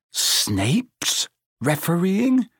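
high, slow, disbelieving splutter. A narrator's reading. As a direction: choke out the news in shock. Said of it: "he spluttered".

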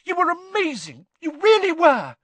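very high squeaky voice. A narrator's reading. Hermione rushing in to praise Harry, squeaky. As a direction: squeak it out with relief. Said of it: "Hermione said squeakily".

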